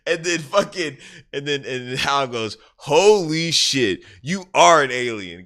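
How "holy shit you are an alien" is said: loud, high, drawn-out mock astonishment; stunned realisation. Hal realising the suspect really is an alien, astounded. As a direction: stretch each word out in amazed discovery.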